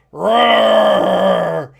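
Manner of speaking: loud drawn-out roar; dragon roar. Acted.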